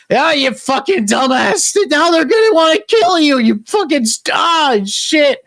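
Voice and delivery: high, straining angry outburst; exasperated scolding. Acted. Scopper at kid who'll get killed, exasperated. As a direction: curse in panicked exasperation.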